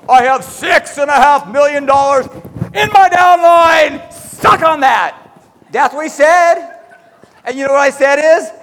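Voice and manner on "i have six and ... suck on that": loud, slow bragging taunt; mock big-shot brag; imitating a boastful leader. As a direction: gloat it from the stage. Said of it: "went on stage and said".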